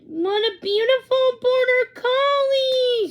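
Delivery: high, slow, gushing admiration; delighted gush. A narrator's reading. Girl admiring the dog, high delighted gush. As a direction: coo over the beautiful dog.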